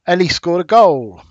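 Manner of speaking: excited exclamation. Shown as a demonstration. Teacher reading an example sentence with an exclamation mark, excited. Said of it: "That's excitement".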